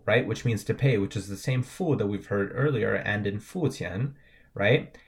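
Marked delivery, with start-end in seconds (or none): none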